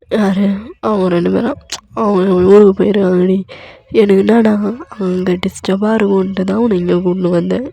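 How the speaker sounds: husband snapping at his wife; loud exasperated outburst; angry shout. Acted.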